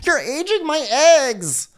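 very high squeaky whine; mock Lindsay. Co-host mocks Lindsay's complaint, very high whine. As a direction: whine it like a spoiled brat.

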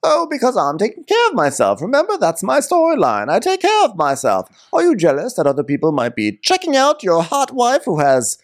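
high, hammed-up grande dame; Karen. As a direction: announce it theatrically in a high voice. Co-host continues Karen impression.

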